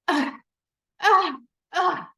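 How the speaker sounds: high, loud put-upon groaning sighs; martyr groans. Retold or imitated. Mother cleaning the kitchen wanting pity, mock martyr groans. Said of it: "raised by a martyr".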